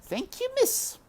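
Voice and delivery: put-on very high country girl voice; Mig thanking. A narrator's reading. Mig thanking the princess, high. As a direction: thank her humbly.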